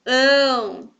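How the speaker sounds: "ão" alone, loud and held long; drawn-out, emphatic "ão"; stressed syllable. Shown as a demonstration. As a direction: call out just "ão", stretched. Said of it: "Vamos chamar cada uma".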